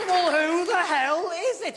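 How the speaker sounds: slow, loud, drawn-out exasperation; exasperated question. Retold or imitated. Guest demanding who's calling, slow loud exasperation. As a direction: drag it out in disbelief.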